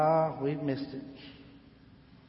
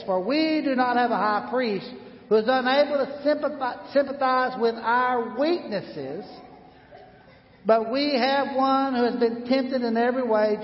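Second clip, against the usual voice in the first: higher, louder Bible reading; scripture reading; 8 semitones higher, 4 dB louder than usual. Acted. Pastor reading Hebrews to the congregation. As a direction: read it out as scripture.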